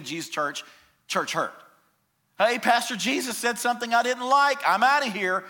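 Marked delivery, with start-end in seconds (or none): 2.4-5.4 mock churchgoer. Mocking a churchgoer who quits.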